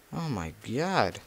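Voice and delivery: ordinary talk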